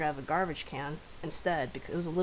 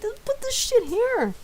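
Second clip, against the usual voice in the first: high, loud, slow mock outburst; exasperated yell; 8 dB louder, slower than usual. Friend finding the puke bowl in cabinets, exasperated yelling. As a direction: draw it out in exasperated disbelief.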